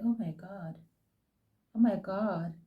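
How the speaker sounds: hushed disbelief at the TV; stunned hush. Retold or imitated. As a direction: barely say it under your breath. Stunned by Andile on TV.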